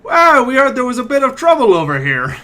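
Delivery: high, loud swaggering sellsword arriving; brash mercenary. Acted. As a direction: barge in like a cocky mercenary. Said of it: "one of these individuals".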